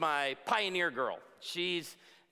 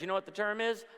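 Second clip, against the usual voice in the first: ordinary talk, nothing marked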